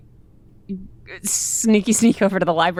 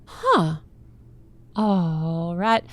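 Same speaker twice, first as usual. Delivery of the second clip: usual speaking voice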